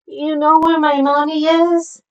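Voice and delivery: put-on high-pitched baby creature voice; baby water dragon. Baby dragon asking about its mommy, high little voice. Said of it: "the baby water dragon".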